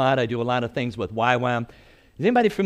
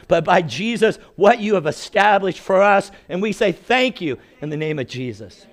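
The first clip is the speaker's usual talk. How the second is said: prayer; 6 semitones higher than usual. Acted. Opening prayer over congregation.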